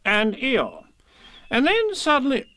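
high, insistent story-character voice; impatient Rabbit. A narrator's reading. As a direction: repeat it pointedly and press on. Rabbit echoes Pooh's interruption.

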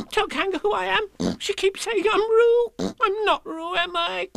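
very high squeaky appeal; frantic little animal complaint; pleading Piglet. Acted. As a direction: beg for backup in a tiny voice. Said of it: "cried Piglet".

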